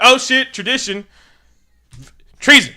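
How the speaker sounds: loud, high shocked cry; hammed-up scandalized outburst; acted alarm. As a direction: cry out the accusation in mock horror. Acted shock, ending in cry of treason.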